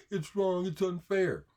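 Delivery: high whiny mimic of complaining opponents; mocking whine. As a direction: whine it like a sulking child. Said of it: "They just wanna say".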